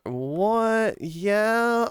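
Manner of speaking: 1 surprised. Real, not acted. Surprised at height.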